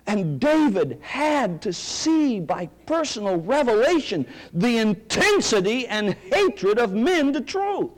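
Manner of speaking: high, slow, driving pulpit climax; preaching at full force. Acted. Preacher warning his congregation, full-force preaching. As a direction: preach it hard, building to the fangs.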